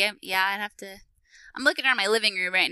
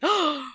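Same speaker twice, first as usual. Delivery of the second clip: dramatic gasp; 6 semitones higher than usual. Pretend. Dramatic reveal build-up.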